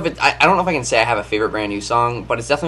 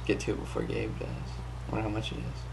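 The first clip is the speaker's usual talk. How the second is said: whispered aside; whispering; 13 dB quieter than usual. Real, not acted. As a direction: whisper it to yourself. Unvoiced aside about house.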